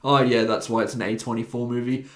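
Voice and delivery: ordinary talk, nothing marked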